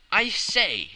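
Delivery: loud, high, jovial exclamation; a merry editor calls out across the table; hilarious. A narrator's reading. Editor teasing the returned traveler, loud and jolly. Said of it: "said the editor, hilarious".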